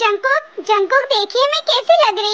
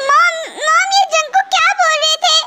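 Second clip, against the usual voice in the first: high, tearful pleading; crying; 7 semitones higher than usual. Acted. Hurt wife crying to her mother-in-law, tearful. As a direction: ask through tears, voice unsteady. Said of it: "पहले तो तुम रोना बंद करो".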